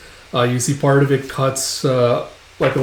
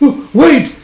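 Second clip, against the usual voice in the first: high, loud acted panic; sudden yelped plea, hammed-up; alarmed plea; 13 semitones higher, 9 dB louder than usual. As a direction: cry out to halt the blow. Enemy about to be killed.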